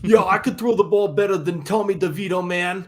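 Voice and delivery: put-on loud, brash New York accent; cocky New York fan talking big. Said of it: "your New York accent is".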